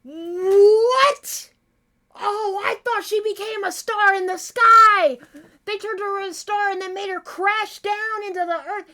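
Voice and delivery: high, loud shock at a sudden reveal; stunned disbelief. Real, not acted. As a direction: cry out as the awful truth lands.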